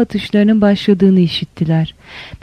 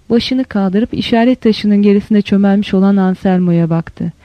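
ordinary delivery

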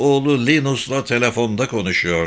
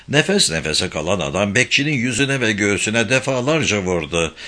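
ordinary delivery

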